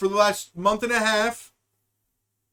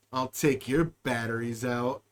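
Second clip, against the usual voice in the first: much lower and softer, menacing; deep quiet robot threat; deep anime robot line; 10 semitones lower, 7 dB quieter than usual. Pretend. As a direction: growl it like a robot villain. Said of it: "bad lines from some sort of anime".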